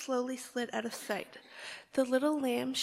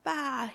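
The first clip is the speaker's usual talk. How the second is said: louder hurt bleat; crying bleat; 2 semitones higher, 6 dB louder than usual. Acted. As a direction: cry out in pain like a lamb. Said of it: "he cried".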